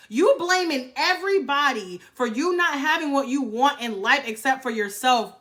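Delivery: ordinary talk, nothing marked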